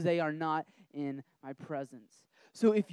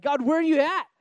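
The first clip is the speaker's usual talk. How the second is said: crying out to God; 9 semitones higher, 9 dB louder, faster than usual. Retold or imitated. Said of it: "am I, like".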